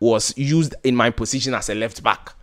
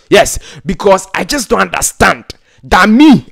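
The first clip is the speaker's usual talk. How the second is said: ordinary talk, nothing marked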